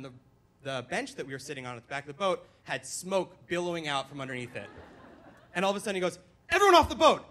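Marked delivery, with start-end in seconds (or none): 6.5-7.3 high, very loud emergency shout; panicked dad yelling. Retold or imitated. Dad ordering family off burning boat, panicked yell. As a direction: bellow orders like a dad in full crisis. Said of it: "emergency crisis management mode and he flipped out".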